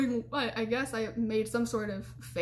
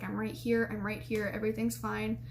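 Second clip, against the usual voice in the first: ground them gently, voice dropped softer; soothing; 6 dB quieter than usual. Retold or imitated. Boyfriend calming her after panic attack, soft reassurance. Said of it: "are really soothing to me".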